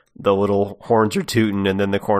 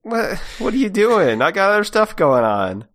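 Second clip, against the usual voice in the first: much higher, flustered cartoon Pete voice; 12 semitones higher, 4 dB louder than usual. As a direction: play Pete high and bewildered, indignant. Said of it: "he's like".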